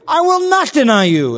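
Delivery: loud, high, insistent declaration; emphatic bold vow. Acted. Preacher acts Peter's bold vow.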